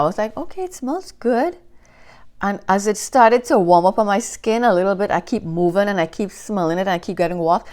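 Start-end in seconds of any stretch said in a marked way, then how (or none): 0.4-1.6 high, soft, noncommittal okay; mild approval. Retold or imitated. Trying the fragrance in the store, high lukewarm approval. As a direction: sound mildly pleased. Lukewarm first reaction retold.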